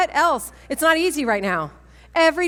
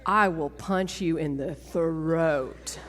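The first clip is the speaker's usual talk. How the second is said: lower, slow, mock solemn God voice with a laugh; playful voice of God; 6 semitones lower, 4 dB quieter, much slower than usual. Retelling the Lord turning her away, in a mock solemn voice. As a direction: lightly voice the Lord refusing her. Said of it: "The Lord was like".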